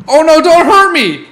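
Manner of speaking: high, loud frightened cry; panicked plea. Real, not acted. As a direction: beg the attacker in panic. Attacked by bat.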